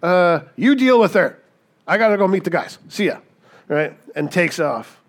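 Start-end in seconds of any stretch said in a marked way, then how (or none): 0.0-3.2 higher, louder, hurried dodge of a husband escaping; breezy brush-off. Retold or imitated. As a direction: toss it off and head for the door. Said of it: "And he says".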